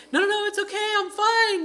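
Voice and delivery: Mickey voiced high and frantic, swearing he's okay; insisting he's fine. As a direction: squeak out that you're fine. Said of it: "sitting there going like".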